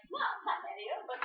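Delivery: high imitation of the coach advising; imitating coach. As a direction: coach advising his young assistant, high mimic. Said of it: "he's like".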